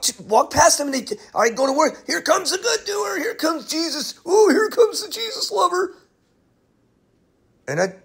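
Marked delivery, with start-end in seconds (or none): 2.1-5.9 high, taunting sing-song, much higher; mocking coworkers. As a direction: mimic the jeering coworkers, high and teasing. Coworkers taunting him, mimicked.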